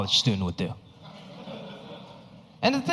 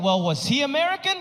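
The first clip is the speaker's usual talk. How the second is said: much higher, doubtful question; lightly voiced officer, incredulous; higher, skeptical officer; 10 semitones higher than usual. Officer questions the detained student, higher and skeptical. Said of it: "He was like".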